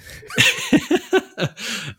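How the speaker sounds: a laugh that shoots up into a squeal; high squealing laugh. Real, not acted. Host cracking up at a co-host's line, squealing. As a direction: squeal with delight. Reacting to a funny quote.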